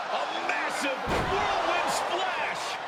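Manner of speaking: high, slow, drawn-out exclamation; excited big-move call. Real, not acted. Live call of big wrestling move.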